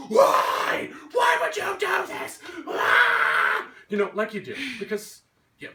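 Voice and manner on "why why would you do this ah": much higher and louder, a hammed-up anguished yell; mock scream. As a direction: overdo the victim's scream. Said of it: "He screams, like".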